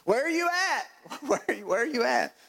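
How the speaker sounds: high-pitched exasperated plea; acted-out frustrated demand; demanding answers. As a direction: call out to Jesus in exasperation, pitch jumping up. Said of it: "talked to Jesus like that".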